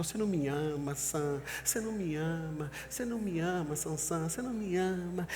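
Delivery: whiny, pleading "você não me ama"; Delilah pouting. Acted. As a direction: sulk like a hurt lover.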